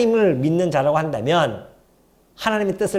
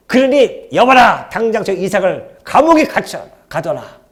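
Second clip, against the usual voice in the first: louder, commanding royal rebuke; angry king; 4 semitones higher, 7 dB louder than usual. Acted. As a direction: bark the king's orders. Angry king reading, booming. (왕이 이삭을 꾸짖는 큰 호통)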